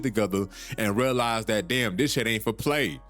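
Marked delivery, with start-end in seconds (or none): none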